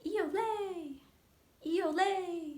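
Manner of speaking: high, fluting bird song; thrush song. Shown as a demonstration. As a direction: sing it like a wood thrush at dusk. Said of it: "Wood thrush turns the twilight still".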